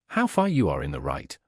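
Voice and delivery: How hit hard, voice dropped low in scorn; cutting retort. A narrator's reading. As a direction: turn Luby's words back on him. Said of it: "retorted Fathom".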